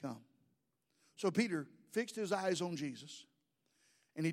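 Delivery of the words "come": quiet invitation. Acted. Jesus's answer, voiced quietly.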